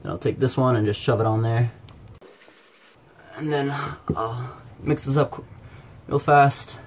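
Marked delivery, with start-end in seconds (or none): none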